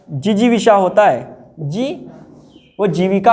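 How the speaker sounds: usual speaking voice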